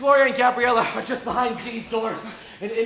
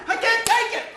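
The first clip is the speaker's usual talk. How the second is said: strained back-and-forth; 6 semitones higher, 4 dB louder than usual. Acted. Two men chanting under strain.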